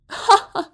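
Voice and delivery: high, loud mocking "ha!"; loud shouted laugh. A narrator's reading. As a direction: burst out with one scoffing laugh. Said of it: "سر سر پاتریک فریاد زد". (خندهٔ بلند و تمسخرآمیز)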